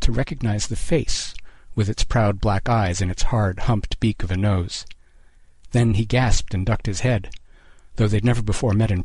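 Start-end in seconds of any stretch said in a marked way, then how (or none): none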